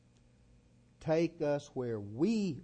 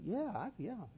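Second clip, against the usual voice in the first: put-on hesitant young man; 11 semitones higher than usual.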